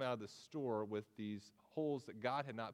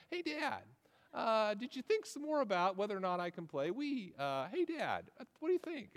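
put-on much higher, pestering kid's voice; a hammed-up restless son begging to play Wii; eager little boy; 6 semitones higher than usual. Son nagging dad again about the Wii, much higher and eager. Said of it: "he begins every question with the phrase".

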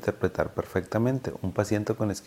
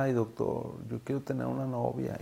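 wistful patient plea; 3 semitones higher, 11 dB quieter than usual. Retold or imitated. Patient shyly tells his doctor he wants a girlfriend, soft plea. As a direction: murmur it like a shy patient asking his doctor. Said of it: "él siempre me decía".

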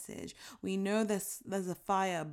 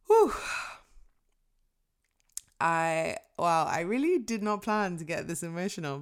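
real emotion welling up; 3 dB louder than usual. Real, not acted. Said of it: "I'm getting emotional".

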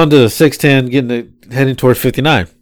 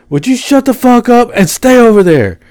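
put-on high, raised snap at the boyfriend; mock angry girlfriend; 10 semitones higher than usual. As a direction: tell him off, hammed up. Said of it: "she goes".

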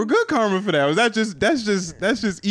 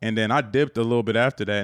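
usual speaking voice

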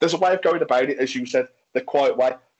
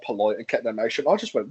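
ordinary delivery

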